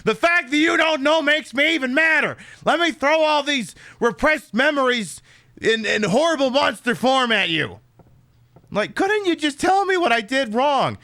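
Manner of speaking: high, loud hammed-up rant as the angry town; mock furious town.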